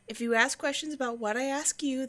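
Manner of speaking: ordinary talk, nothing marked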